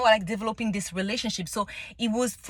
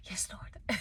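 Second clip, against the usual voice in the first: whispered prayer; 11 dB quieter than usual. Real, not acted. Grateful aside to God.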